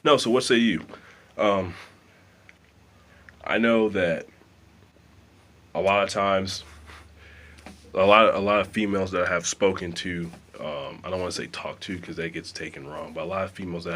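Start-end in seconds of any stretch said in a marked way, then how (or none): 0.0-10.3 louder, punching each phrase; loud and emphatic. Real, not acted. Vlogger asking his viewers the question again, loud. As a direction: come back in strong to the camera.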